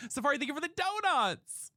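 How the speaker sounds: high, gushing thank you; excited thanks. Real, not acted. Streamer thanking a viewer for donuts, excited thanks. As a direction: pitch the thanks up high with delight.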